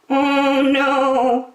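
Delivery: high, loud singsong refusal; Lambkin's voice. A narrator's reading. Lambkin refuses the hamster, high and singsong. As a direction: sing out a high little no. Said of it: "said Lambkin".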